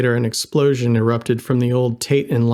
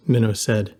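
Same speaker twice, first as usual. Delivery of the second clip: ordinary delivery, nothing marked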